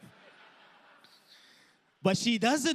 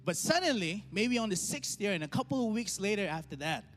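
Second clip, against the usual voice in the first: rising sermon delivery; 5 semitones higher than usual. Acted. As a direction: preach it with climbing pitch. Builds sermon illustration.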